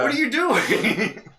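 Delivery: drawn-out, high, loud mock yell; retold outrage. Customer challenging the one who shoved them, mock shouting. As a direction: stretch it into an incredulous acted shout.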